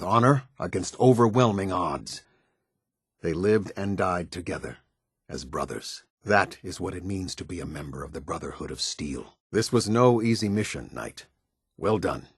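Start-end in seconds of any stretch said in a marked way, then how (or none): 3.2-9.3 softer, lower, grave respect; solemn tribute. Acted. Commander honouring the lost team, solemn. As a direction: honour the fallen quietly.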